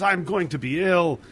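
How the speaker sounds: put-on high-pitched, urgent old man voice; queasy old man. Old man about to vomit warns the driver, urgent and queasy. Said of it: "and he says".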